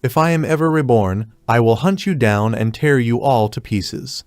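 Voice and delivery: plain reading voice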